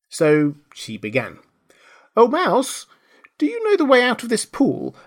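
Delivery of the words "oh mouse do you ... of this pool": high, formal appeal as Alice; Alice addressing the mouse. A narrator's reading. Alice asks the mouse the way out, high and appealing. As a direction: call politely on a stranger in a high voice.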